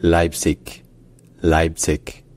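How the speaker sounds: Leipzig ending in a k; southern German pronunciation. Shown as a demonstration. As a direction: say Leipzig with a crisp k at the end. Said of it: "dans certains dialectes du sud de l'Allemagne".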